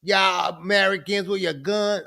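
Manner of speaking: bad Australian accent. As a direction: scold in a badly faked Aussie accent. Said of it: "I gotta, I can't do it".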